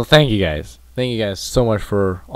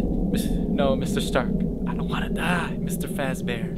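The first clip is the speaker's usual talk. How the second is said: whisper; 5 dB quieter than usual. Pretend. Infinity War quote parody.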